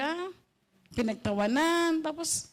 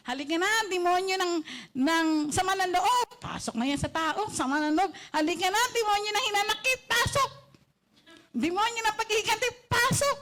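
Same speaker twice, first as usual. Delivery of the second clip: high, luring call, rising on pasok; demon of anger calling; 6 semitones higher than usual. Acted. Demon of anger inviting other demons into a person, high and luring. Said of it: "sabi ng demonyo ng galit".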